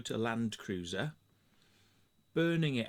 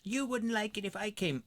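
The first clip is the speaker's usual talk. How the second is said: much higher mocking whine; mock landowner voice; 11 semitones higher than usual. Mimicking the landowner telling him off, high whine. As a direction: parody her whining complaint. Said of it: "She said".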